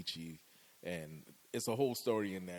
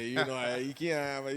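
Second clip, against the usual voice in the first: louder, lilting Bahamian twang; sing-song Bahamian accent; 3 semitones higher, 5 dB louder than usual. Shown as a demonstration. Showing off the beauty of Bahamian accent, sing-song lilt. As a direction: put the Bahamian singing thing on it, playfully. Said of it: "put that little singing thing on there".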